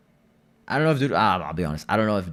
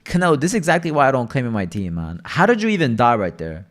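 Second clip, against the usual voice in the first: loud, higher exasperated call-out at a teammate; frustrated shout at Canelo; exasperated yelling; 5 semitones higher, 7 dB louder than usual. Real, not acted. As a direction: yell at the teammate in disbelief. Scolding a teammate who died.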